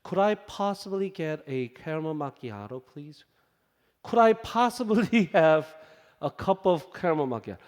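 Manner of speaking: very slow, exaggeratedly polite English; overly polite order. Friend ordering coffee at Starbucks, mock overly polite. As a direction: drag out each word like a nervous posh customer. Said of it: "영어를 정말 고급스럽게 구사하는".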